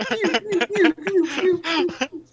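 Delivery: high-pitched play-gun pews; pew noises. Pretend. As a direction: fire off squeaky mouth pews. Imitates shots or siren in scene.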